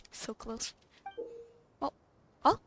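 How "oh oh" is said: ordinary talk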